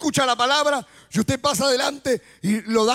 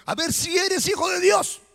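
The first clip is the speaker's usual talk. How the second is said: higher-pitched, doubting challenge; duda desafiante; 6 semitones higher than usual. Shown as a demonstration. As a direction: dare him to prove it, voice lifted. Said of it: "Le dijo". (reto con duda, en tono agudo)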